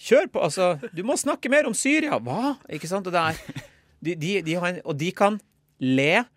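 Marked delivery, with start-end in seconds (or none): 0.0-2.2 high, loud rowdy heckler yelling; mock heckler shout. Northern audience member shouting at the comedian, hammed-up yell. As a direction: egg the comic on like a rowdy northern crowd. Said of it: "folk roper".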